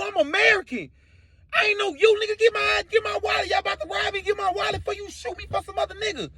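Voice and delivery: high-pitched indignant panic, hammed-up; wailing acted outrage; frantic denial. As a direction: plead mistaken identity in a squealing voice. Acts frantic denial at gunpoint.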